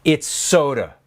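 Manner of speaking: normal talking voice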